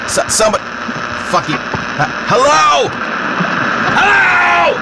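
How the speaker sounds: high calling hello into a mic. Real, not acted. Mic check, nobody hears him.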